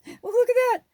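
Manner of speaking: sudden high, loud burst; excited exclamation. Real, not acted. Vlogger distracted by fun lights, loud excited outburst. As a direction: burst out in delighted amazement.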